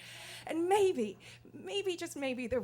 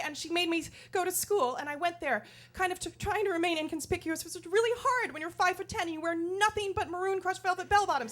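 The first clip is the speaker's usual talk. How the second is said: ordinary talk, nothing marked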